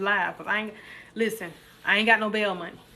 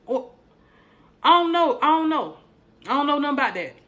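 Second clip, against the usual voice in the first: hammed-up denial; 4 semitones higher than usual. Streamer acting clueless for imagined police, hammed-up denial. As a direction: insist you know nothing, acting innocent.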